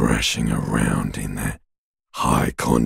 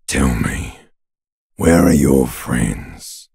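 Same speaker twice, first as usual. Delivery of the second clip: ordinary delivery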